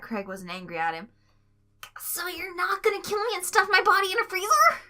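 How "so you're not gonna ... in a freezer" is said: high, anxious voice; nervous question. A narrator's reading. Tweek asking Craig if he'll kill him, high anxious voice. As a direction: ask with jittery dread. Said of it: "Tweek questioned".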